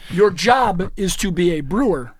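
slow, with audible air quotes; sarcastic. Real, not acted. Lecturing brewers on their job, slow and sarcastic. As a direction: stretch it out with heavy sarcasm. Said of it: "Van just had the sarcasm, uh, air quotes".

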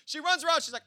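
ordinary delivery

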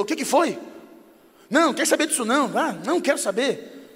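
mock testy relative at the limit; stressed snappish retort. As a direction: refuse to listen, pitch jumping up. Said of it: "Estava meio estressado, chegou em casa meio no limite".

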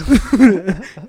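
loud falling laugh. Real, not acted. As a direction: burst out laughing. Laughing at story.

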